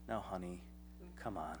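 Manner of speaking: calm him like a patient wife; gentle wife voice. Retold or imitated. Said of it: "then she'll say".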